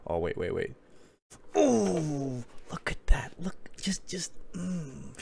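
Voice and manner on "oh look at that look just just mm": breathy whisper through the stretch; pained under-the-breath whisper; strained whisper. Real, not acted. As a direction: whisper it as if it hurts. Strained while stretching on camera.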